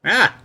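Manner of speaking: sharp, high, loud startled yell; a real jolt of shock out loud. Real, not acted. Sudden shock at the screen.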